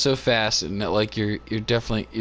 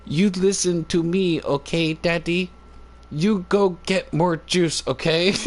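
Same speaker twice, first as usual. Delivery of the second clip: put-on lightly higher, slow little girl voice giving orders; imitating toddler bossing; 5 semitones higher than usual. Little daughter bossing her daddy about juice, mildly high and slow. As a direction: play a tiny bossy daughter, slowly.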